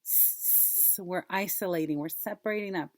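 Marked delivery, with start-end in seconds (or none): 0.0-1.0 a long soft hissed S; only the S sound, not the letter name; final sound alone. Shown as a demonstration. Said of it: "What's the final sound?".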